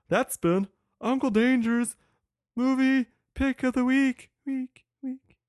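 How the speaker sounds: high, slow showman sign-off voice; announcer voice. Acted. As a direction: announce it like a radio host. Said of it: "show sign-off announcer voice".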